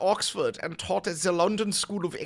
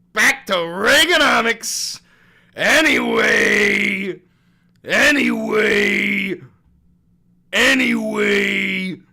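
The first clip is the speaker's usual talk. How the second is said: loud, slow drawn-out anyway; drawn-out shout; 4 semitones higher, 10 dB louder, slower than usual. Real, not acted. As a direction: shout it long to change topic. Loud drawn-out topic change.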